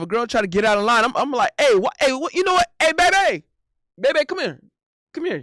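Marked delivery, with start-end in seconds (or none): 1.6-5.4 put-on high, urgent call to a girlfriend; mock-alarmed, higher-pitched shout for help; acted calling out. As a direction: summon your partner as if you need backup. Acts out calling his girlfriend over.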